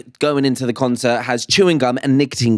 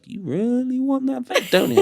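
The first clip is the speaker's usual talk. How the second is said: tempting inner voice; 9 semitones higher, 3 dB quieter than usual. Acted. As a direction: lure him in like a devil. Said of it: "sweet talking in my head".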